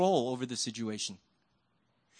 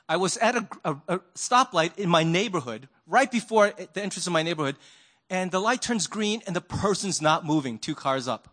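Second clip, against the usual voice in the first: ordinary talk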